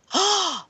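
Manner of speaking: sudden high shocked gasp; sharp gasp. Retold or imitated. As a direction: suck in air in shock. Team realizes Charlie is upset.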